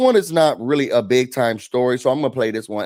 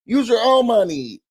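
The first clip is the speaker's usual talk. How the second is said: mock kids' complaint; 7 semitones higher than usual. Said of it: "they're like".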